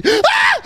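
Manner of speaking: high, sudden scream of fright; real high-pitched yelp of shock; startled scream. Real, not acted. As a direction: scream out at a jump scare. Jump scare in horror game.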